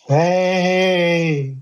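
high, drawn-out airy call. A narrator's reading. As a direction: call her name as if on the wind. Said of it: "an airy voice called".

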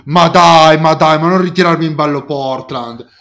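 loud, laughing mock whine; mock exasperated protest. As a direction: imitate a fed-up fan. Mocking the other's protest.

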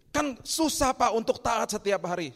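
high, loud mock whine; whiny objection. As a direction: act out a believer moaning that it's hard. Voicing congregants' complaint again.

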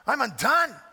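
preacher voicing Isaiah's anguished cry. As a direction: cry out in despair. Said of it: "He, he falls apart".

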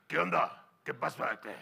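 hammed-up Sonoran speech, sinking into mumble; Sonoran talk. Preacher imitating how Sonorans talk, exaggerated Sonoran mumble. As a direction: parody a big Sonoran man's garbled greeting. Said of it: "Y cuando hablan".

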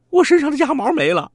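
high, loud, fast cry of joy; overjoyed. Acted. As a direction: burst out with delight. Thief overjoyed feathers gone. (高兴得尖声大叫)

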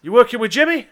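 loud, high accusing question; acted sharp accusation; accusing demand. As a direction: demand an answer from him. Armed woman accuses detective.

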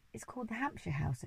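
soft, hushed reply; a boy whispering to the giraffe. A narrator's reading. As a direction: whisper it secretly.

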